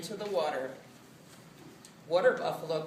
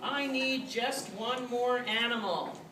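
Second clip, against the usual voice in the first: raised, higher emperor voice; calling out; 6 semitones higher than usual. A narrator's reading. Emperor needing one more animal, high call. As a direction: call it out to all the racers.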